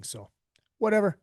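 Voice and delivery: normal talking voice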